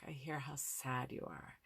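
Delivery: soft, gentle reflection of sadness; empathetic reflecting. Shown as a demonstration. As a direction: mirror the child's sadness back softly. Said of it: "to really reflect that back".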